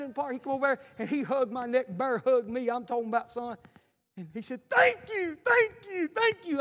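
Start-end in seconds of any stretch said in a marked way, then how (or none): 4.7-6.6 tearful thanks. Retold or imitated. Grateful man hugging preacher.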